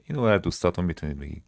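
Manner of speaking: ordinary delivery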